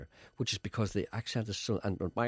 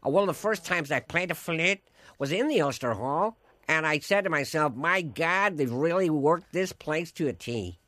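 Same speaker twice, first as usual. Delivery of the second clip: higher impression of a flute player; chatty musician's voice, pitched up and measured; 6 semitones higher, 4 dB louder than usual. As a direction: play the globe-trotting musician telling an anecdote. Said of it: "[name] talks like that".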